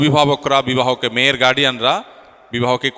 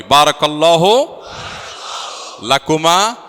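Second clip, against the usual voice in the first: slow, raised call with rising ends; leading a prayer; 3 semitones higher, much slower than usual. Real, not acted. Officiant leading all the guests in the blessing, raised call-and-repeat.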